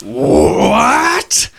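high, slow, shouted outrage; dragon voice. Acted. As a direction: shriek it in disbelief. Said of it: "And he goes".

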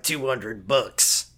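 low, slow, blunt price; gruff low voice. Retold or imitated. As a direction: drop it down flat and slow. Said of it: "the guy's like".

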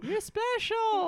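very high, drawn-out squeal; goofy Faraday parody. Acted. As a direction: stretch it out in a silly high voice. Mocking Faraday's magical line.

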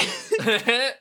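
high hammy villain cackle; villain laugh. Acted. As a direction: cackle like a cartoon bad guy. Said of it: "Kingdom Hearts level villain monologue in stupid voice".

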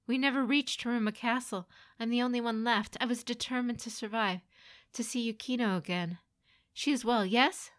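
anxious pleading. Acted. Lone survivor explaining himself to mother, anxious pleading. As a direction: explain urgently, ending on a hopeful question.

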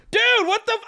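shocked high-pitched outburst; half-joking yell. Retold or imitated. Customer yelling at a drive-through kid, half joking. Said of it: "employees heard me yelling".

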